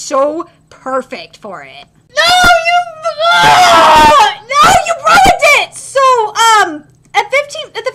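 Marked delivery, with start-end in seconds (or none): 2.1-5.7 high wail of protest; dismayed outcry. Real, not acted. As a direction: cry out as a betrayed fan. Wails that studio ruined it.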